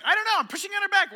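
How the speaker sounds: high, loud, panicked defending; flustered shout. Retold or imitated. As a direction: yell it like a lost husband. Flustered husband questioned.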